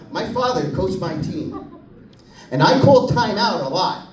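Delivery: ordinary talk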